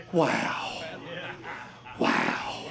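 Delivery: breathless whispered wow; awed whisper. Retold or imitated. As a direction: whisper it in quiet wonder. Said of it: "I can imagine as he says".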